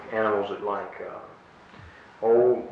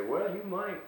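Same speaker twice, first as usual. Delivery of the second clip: doubtful; 10 semitones higher, 8 dB quieter, slower than usual. Shown as a demonstration. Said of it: "you'd say".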